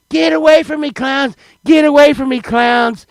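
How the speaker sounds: high, loud shooing cry; mock panicked yell. Four-year-old driving clowns away, high panicked yelling. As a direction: yell it high, warding them off.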